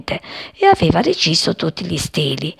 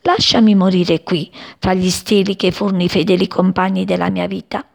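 ordinary delivery, nothing marked

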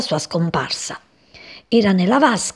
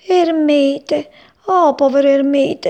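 high, louder cry of pity; distressed exclamation; 7 semitones higher, 5 dB louder, slower than usual. A narrator's reading. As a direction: exclaim in alarm for a poor friend. Frog laments mouse's plight.

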